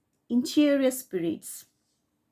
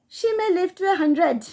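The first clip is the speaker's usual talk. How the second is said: higher and louder, fed-up outburst; exasperated complaint; 8 semitones higher, 5 dB louder than usual. A narrator's reading. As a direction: throw it up in frustration, pitched up. Paul complains about aunt's longevity.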